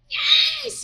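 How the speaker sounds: high delighted yelp of joy; excited cheer. Retold or imitated. Friend on the phone cheering her record deal, shrieking high. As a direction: shriek it like the best news ever.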